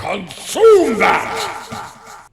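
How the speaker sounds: high, very slow, loud booming order; terrifying command. Retold or imitated. Retelling the priest's terrifying order, slow booming yell. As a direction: boom it like the scary priest. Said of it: "It's terrifying".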